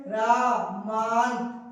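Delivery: रामांत stretched very slowly with a low, soft final त; slow soft ending. Shown as a demonstration. Teacher drawing out रामांत to show the halant. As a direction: drag रामांत out and let it sink. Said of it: "इस रामांत कस के बोलेंगे".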